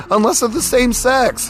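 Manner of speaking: high, fast, laughing through the words; can't hold back a giggle at your own joke. Real, not acted.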